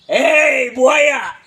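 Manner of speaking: high mocking yell; teasing shout. Calls friend a playboy. (teriakan mengejek, nada tinggi)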